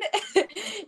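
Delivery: high, short giggle; light laugh. Real, not acted. Laughing at her breakdown story.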